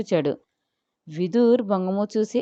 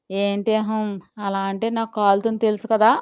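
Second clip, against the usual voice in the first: usual speaking voice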